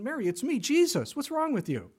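brash mock voice; mock exasperated Jesus.

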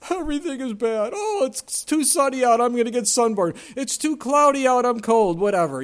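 high, loud, whiny Eeyore moan. Retold or imitated. Preacher mocking the gloomy donkey Eeyore, whiny high moan. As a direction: play the gloomy donkey who hates every weather. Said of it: "the Eeyore mentality".

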